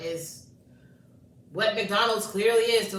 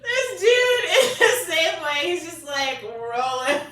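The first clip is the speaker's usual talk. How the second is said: laughing; 7 semitones higher, 5 dB louder, slower than usual. Real, not acted. Laughing while telling.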